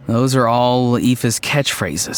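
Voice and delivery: usual speaking voice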